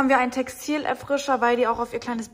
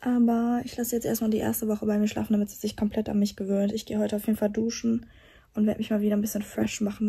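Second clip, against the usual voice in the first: ordinary talk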